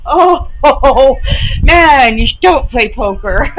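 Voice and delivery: high, loud laughing teasing; laughing at a coworker's gleeful face; laughing tease. Retold or imitated. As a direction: tease her through laughter. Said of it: "I'm like".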